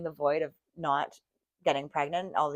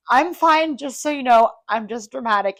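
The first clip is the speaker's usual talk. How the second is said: teary reassurance; 6 semitones higher, 8 dB louder than usual. Retold or imitated. Retelling teary talk with nurse.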